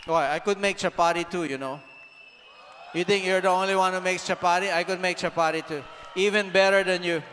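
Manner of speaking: loud, proud teasing boast about cooking; shouted boast. Retold or imitated. Preacher boasting to the congregation he cooks chapati better, loud. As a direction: shout it like a cocky cook showing off.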